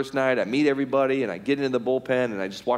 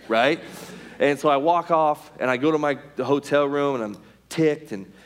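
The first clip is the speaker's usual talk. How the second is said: usual speaking voice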